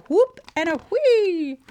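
high, loud playful cheer on the sound words; storybook whoop. A narrator's reading. Reader ends the race, high playful whooping. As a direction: let whoop and whee soar upward.

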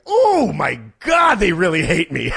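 high, slow, loud cry of dismay; hammed-up dismay; mock horrified outburst. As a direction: overplay the shock like the sky fell. Mock panic at hearing news.

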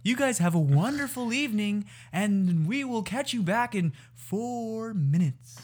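high, lilting podcast sign-off; sing-song announcer patter; radio host impression. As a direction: gush like a cheesy broadcast host. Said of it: "you gotta do that again".

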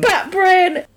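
high-pitched exclamation of silly delight; high, comic outcry; excited outburst. Real, not acted. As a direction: burst out high at the absurd idea. Mock horror at silly guess.

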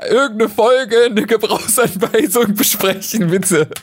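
high, laughing plea, slowed; laughing while pleading. Real, not acted. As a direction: beg through laughter. Laughing at a silly idea.

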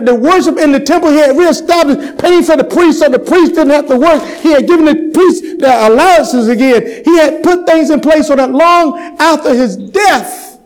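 high, ringing pulpit delivery; full-force preaching. Real, not acted.

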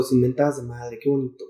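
ordinary talk

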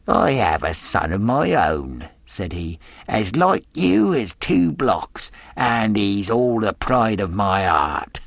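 leering, wheedling pirate voice; a fawning seaman with a hidden threat; sly old sailor. Acted. Stranger voiced in read story.

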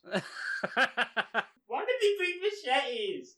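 high stretched bewildered laugh; laughing disbelief. Real, not acted. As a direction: ask it through helpless laughter.

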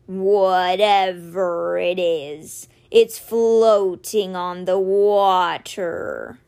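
slow, deep, watchful voice; Simber's voice. Acted. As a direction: drag each word out low like a wary beast. Simber voiced, eyes narrowed.

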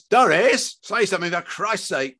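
high, sharp appeal to his wife; angry husband out of patience; exasperated demand. Acted. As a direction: demand that she speak up. Father demands wife speak.

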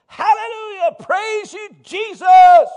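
high-pitched hallelujah building to a loud cry; exuberant praise shout. Retold or imitated. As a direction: shout praise with hands in the air. Acting out his praise to coax her.

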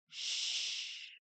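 "sh" as a soft voiceless hiss, no vowel; sh sound. Shown as a demonstration. Teacher isolates the sh sound for Spanish speakers, hushed. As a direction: push out a quiet hushing "sh". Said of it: "el sonido".

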